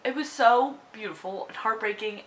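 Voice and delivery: ordinary talk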